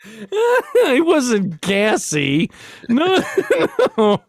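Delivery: laughing. Real, not acted. Joking aside amid laughter.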